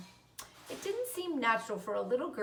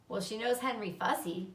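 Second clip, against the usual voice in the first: ordinary delivery, nothing marked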